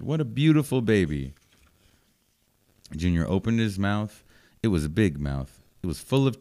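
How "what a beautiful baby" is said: higher, louder delighted exclamation; excited. Acted. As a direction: gush over the new baby. Mister Bird very excited.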